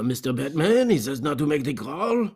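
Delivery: hammed-up French-Canadian accent; mock French-Canadian, questioning lilt; bad French accent. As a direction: put on a fake Montreal French accent. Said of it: "that's what people in Toronto sound like".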